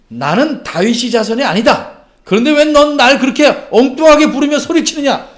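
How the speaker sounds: loud, high-pitched telling-off; scolding. Acted. Imagined Jesus scolding the beggar for his shouting, angry rebuke. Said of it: "이렇게 야단치고 타이르셨습니다".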